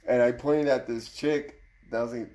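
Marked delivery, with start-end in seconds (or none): none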